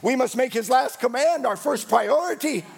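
ordinary talk

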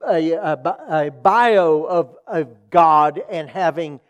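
loud, emphatic sermon delivery; forceful preaching. Real, not acted. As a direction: drive the point home, leaning hard on key words. Preaches the point at full force.

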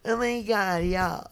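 put-on high-pitched Valley girl American accent.

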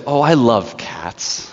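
slow, drawn-out mock fondness. Witch mocking the child's cat comparison, slow feigned delight. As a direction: purr it slowly as a mocking witch. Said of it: "There she is, just mocking them".

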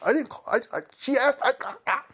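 high, stammering self-defense; flustered protest. Retold or imitated. Defending himself to friends, flustered high stammer. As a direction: stammer out a mock-panicked denial.

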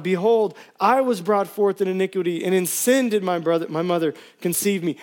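pleading psalm read with rising intensity; impassioned scripture reading. Acted. Pastor reading a psalm to church, pleading. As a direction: preach the plea as your own. Preacher reads the psalm aloud.

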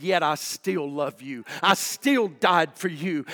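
slow, earnest declaration, starting low; measured, heartfelt promise; loving assurance. Retold or imitated. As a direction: give each word tender weight. God's loving reply, voiced.

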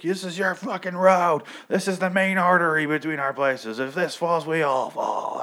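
high mock yell at a guard; imitating an angry leader chewing someone out; hammed-up angry rant; retold yelling. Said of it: "yelling at this dude".

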